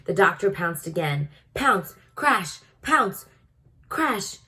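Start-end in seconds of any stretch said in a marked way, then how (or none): none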